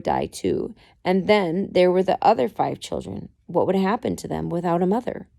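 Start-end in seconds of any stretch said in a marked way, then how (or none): none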